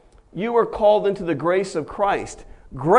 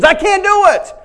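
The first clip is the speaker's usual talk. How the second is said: much higher, loud wail of refusal; desperate cry; 12 semitones higher, 8 dB louder than usual. Acted. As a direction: cry it out in panic. Preacher acts Jeremiah's refusal.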